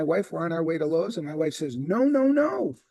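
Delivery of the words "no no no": pitched high, a quick run of protest no's; urgent objection. Retold or imitated. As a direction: imitate the wife cutting him off. Wife objects to going to Lowe's.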